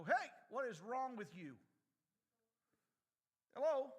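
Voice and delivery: loud call to stop a fight; hey, calling across the street. Retold or imitated. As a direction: shout at them to break it up.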